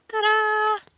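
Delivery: high bright ta-da; ta-da flourish. Real, not acted. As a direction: present it with a flourish. Presenting finished install.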